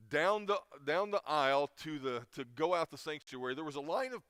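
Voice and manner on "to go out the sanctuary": ordinary talk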